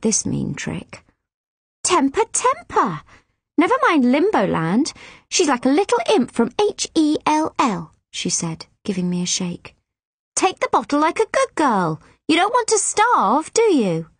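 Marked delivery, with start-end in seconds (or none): 1.8-7.9 higher, sing-song scolding nurse; mocking nurse. Harsh nurse mocking the yelling baby, high and sly. 10.4-14.1 higher, sing-song scolding nurse; mocking nurse. Harsh nurse mocking the yelling baby, high and sly.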